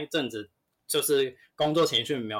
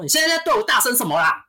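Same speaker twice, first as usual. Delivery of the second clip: higher, louder, imitating the customer's shout; mock angry yell; 11 semitones higher, 8 dB louder, faster than usual. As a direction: bark it back like an offended customer. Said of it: "他真的直接在里面大吼". (模仿顾客大吼、语气高又凶)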